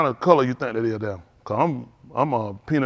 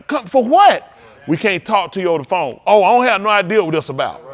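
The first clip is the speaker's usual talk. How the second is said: loud, indignant phone reply; acted loud protest; 4 semitones higher, 9 dB louder than usual. Parent answering a call to come down, acted loud protest. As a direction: push back loudly, acting out a baffled parent.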